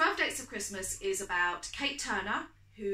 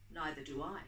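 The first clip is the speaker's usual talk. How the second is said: low and suggestive, a hint of flirting; 5 semitones lower, 7 dB quieter than usual. A narrator's reading. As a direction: drop the voice into a quiet suggestive tease. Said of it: "His tone was suggestive".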